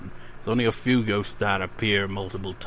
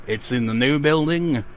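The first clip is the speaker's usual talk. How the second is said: normal talking voice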